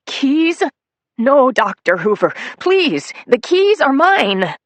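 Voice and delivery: high, loud desperate plea; pleading whine. Acted. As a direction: beg to keep the keys. Nettie pleads.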